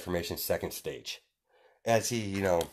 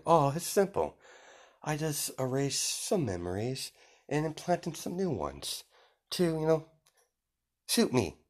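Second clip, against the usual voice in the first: high, put-on character voice; airy villain voice; 8 semitones higher, 3 dB quieter than usual. Villain explaining erased memories, in high airy voice. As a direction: keep it high and breezy.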